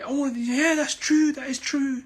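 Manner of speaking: high-pitched mock eager agreement; mocking, excitable yes-man voice; gullible agreement. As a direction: imitate a gullible follower being won over. Mimics gullible followers eagerly agreeing.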